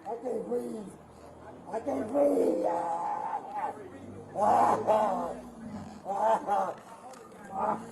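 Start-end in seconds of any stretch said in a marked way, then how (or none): 1.7-7.8 loud cries breaking into shouts; pained shouting. Real, not acted. Man held on the ground shouts in pain to the officers.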